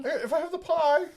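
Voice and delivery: high falsetto film-character voice; Mrs. Doubtfire. Acted. Husband answering his wife's doubt about his accent, high falsetto impression. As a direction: keep up the squeaky housekeeper impression. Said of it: "you can't do that accent".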